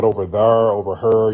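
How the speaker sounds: "there" as "thurr", "her" for "here"; river-town R in "there" and "her"; intrusive R. Shown as a demonstration. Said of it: "that intrusive R from the Irish".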